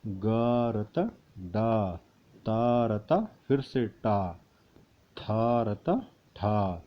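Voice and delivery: slow letter by letter; slow spelling review. Shown as a demonstration. Teacher reviewing letters slowly for students. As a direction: run through the letters slowly. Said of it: "एक बार फिर हल्के से नज़र मारेंगे".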